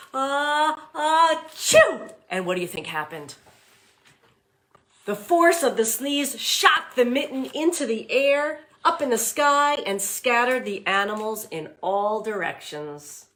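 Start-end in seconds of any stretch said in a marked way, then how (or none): 0.1-2.1 a hammed-up sneeze building to a high, loud chew; big fake sneeze. Shown as a demonstration. Teacher leading children in a sneeze, big hammed-up sneeze. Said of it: "can you sneeze with me?". 5.1-13.3 higher, louder storybook narration of the sneeze; excited read-aloud. A narrator's reading. Teacher reading to class, excited storybook voice.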